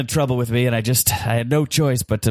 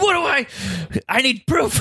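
frantic shouting hostage taker; 9 semitones higher than usual. Acted.

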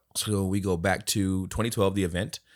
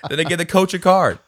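ordinary talk

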